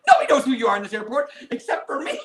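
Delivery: mock outrage broken by a laugh; indignant inner rant. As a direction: huff it to yourself with a laugh. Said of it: "in my head".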